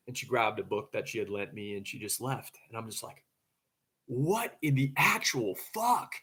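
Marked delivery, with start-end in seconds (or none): 4.1-6.1 higher, louder, drawn-out astonishment; retold disbelief. Man reacting as girlfriend walks out, slow loud disbelief. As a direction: spell out each word slowly in acted shock.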